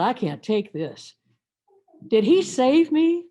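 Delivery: ordinary delivery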